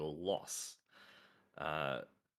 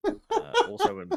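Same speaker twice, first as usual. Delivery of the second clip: much higher, louder, breaking into laughter; laughing; 21 dB louder than usual. Real, not acted. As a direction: try to read on but crack up. Cracks up mid news read.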